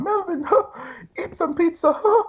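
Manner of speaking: high mocking impression of Paul; squeaky high put-on voice pushing pizza. Melvin mimicking Paul urging him to eat pizza, high mocking voice. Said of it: "That's a good impression".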